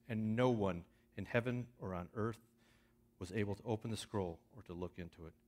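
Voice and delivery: loud, ringing Bible reading, rising in force; scripture reading. Acted. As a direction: proclaim the text to the whole congregation.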